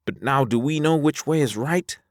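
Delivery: high character voice, pressing question; high-voiced Alvar, questioning. Alvar unsure of the way, high questioning voice. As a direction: ask it up in a high register.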